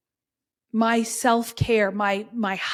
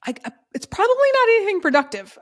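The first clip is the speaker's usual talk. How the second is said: accidental high voice; 9 semitones higher, 4 dB louder, faster than usual. Real, not acted. Podcast coach warning listeners, voice accidentally squeaking up high. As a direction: voice suddenly jumps high and quick. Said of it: "my voice got so high".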